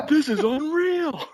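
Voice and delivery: high, loud, drawn-out mock amazement; excited fanboy. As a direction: squeal it like an overexcited fan. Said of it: "all excited and going".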